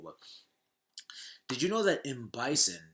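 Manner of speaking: ordinary delivery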